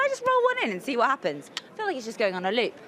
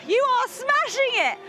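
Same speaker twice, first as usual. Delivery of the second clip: excited praise; 12 semitones higher than usual. Real, not acted. Cheering during game.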